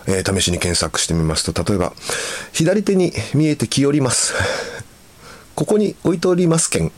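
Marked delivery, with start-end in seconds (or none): 2.5-6.9 higher-pitched Hakata dialect examples; lightly performed Hakata dialect; Hakata polite dialect. Shown as a demonstration. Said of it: "博多弁にデスマスをつけて敬語のように".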